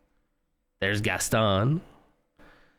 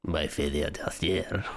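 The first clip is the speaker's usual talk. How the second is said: slow, softer, lower mock French accent; one more lingering French accent take; 6 semitones lower, 4 dB quieter, slower than usual. Streamer trying Ewan McGregor's French accent. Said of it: "I can do it too, right?".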